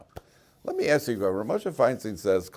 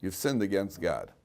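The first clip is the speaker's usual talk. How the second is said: normal talking voice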